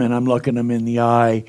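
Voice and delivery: ordinary delivery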